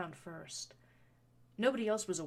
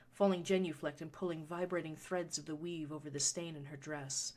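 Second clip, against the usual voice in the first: ordinary delivery, nothing marked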